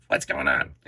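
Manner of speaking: deep goofy figure voice; Morph voice. Pretend. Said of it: "I'm just making him like that".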